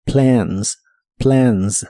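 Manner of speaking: "Plans" with a vowel between e and a; "Plans" flat open vowel; model word. Shown as a demonstration. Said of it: "combinación entre el sonido E y el sonido A".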